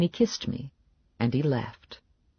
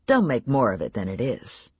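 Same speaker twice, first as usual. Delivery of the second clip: ordinary delivery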